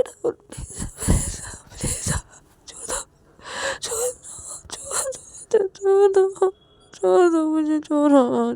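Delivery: high sobbing cries, some whispered, some loud; crying and yelling. Acted. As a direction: wail and plead while crying. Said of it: "रोते-रोते वो चिल्लाते-चिल्लाते". (रोते-रोते चिल्लाना)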